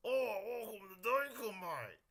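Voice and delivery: broad mock-Australian welcome; Australian accent. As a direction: greet like a hammed-up Australian. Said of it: "one accent that I can do perfectly".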